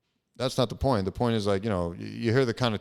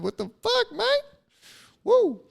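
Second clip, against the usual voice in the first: much higher, giddy lightheaded yelp ending in a whoop; giddy squeal; 5 dB louder than usual. Real, not acted. Lightheaded host whooping to his listeners, giddy high squeal. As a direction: squeal it out like the room is spinning. Feeling lightheaded, goofing around.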